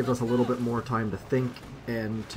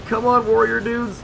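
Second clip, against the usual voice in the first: high, loud desperate urging; real frantic yell at the game; loud pleading; 10 semitones higher, 8 dB louder than usual. Real, not acted. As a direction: beg the troops on at full volume. Pleading as troops die.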